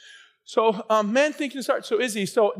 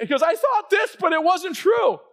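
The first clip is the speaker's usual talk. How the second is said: high, loud preaching quote; emphatic quote; 7 semitones higher, 4 dB louder, slower than usual. Retold or imitated. Preacher quoting sister's testimony, loud and high. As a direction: proclaim it to the congregation.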